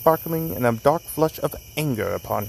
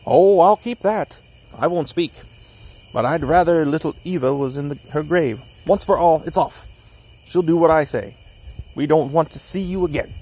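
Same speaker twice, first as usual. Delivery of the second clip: raised-pitch, indignant argument; heated quarrel; 4 semitones higher, 3 dB louder than usual. A narrator's reading. Young Lee refusing Bellingham his sister, heated and angry. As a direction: argue it firmly, temper up. Acted quarrel over broken engagement.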